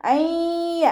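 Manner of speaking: അയ്യ chanted high with the ya merged and nasalized; അയ്യ sung as one nasal merged sound; tajweed demo. Shown as a demonstration. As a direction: chant അയ്യ with ghunna on the doubled ya. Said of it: "ഇതുപോലെ ഉച്ചരിക്കുക".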